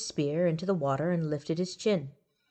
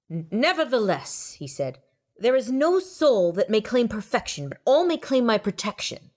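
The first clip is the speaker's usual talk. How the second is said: higher, grand proclamation; solemn higher god voice; lofty god; 6 semitones higher than usual. A narrator's reading. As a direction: declare it like a righteous god.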